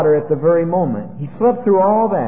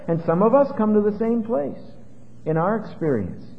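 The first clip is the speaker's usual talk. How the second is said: usual speaking voice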